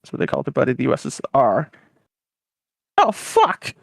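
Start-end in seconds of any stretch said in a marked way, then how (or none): 3.0-3.7 high, loud comic dismay; hammed-up shocked greeting; alarmed surprise. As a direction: blurt it out in a high, startled voice. America startled by arrival of USSR.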